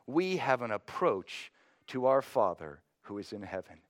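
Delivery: normal talking voice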